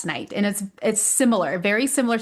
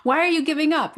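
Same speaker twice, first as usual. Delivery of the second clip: higher, mock-annoyed child accusing; frustrated kid; 7 semitones higher, faster than usual. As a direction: imitate a cross kid, lightly hammed. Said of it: "getting really mad and frustrated".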